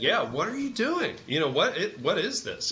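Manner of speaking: high, insistent mock demand; demanding answer. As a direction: press him for an explanation. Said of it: "demanding an answer".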